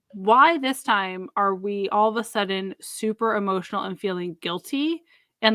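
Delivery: normal talking voice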